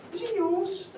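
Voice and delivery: high, incredulous mother; mother's voice. Retold or imitated. Mum asking her son on the phone, high and shocked. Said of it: "First thing out of my mother's mouth".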